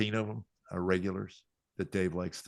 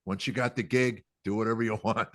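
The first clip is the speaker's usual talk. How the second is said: retold scolding; 3 semitones higher, 7 dB louder than usual. Managers scolding him, retold.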